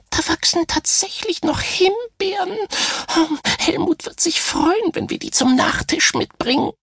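high, piping little voice; squeaky little starfish. Acted. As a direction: pipe it up high like a tiny creature. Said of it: "piepste der kleine Seestern".

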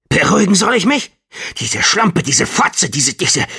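high, loud furious outburst; angry shout. Acted. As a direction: spit out the insults in rage. John rages at injured Ophelia.

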